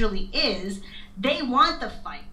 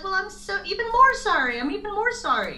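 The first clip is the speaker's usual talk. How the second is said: mock groveling; 9 semitones higher than usual. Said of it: "They'll be like".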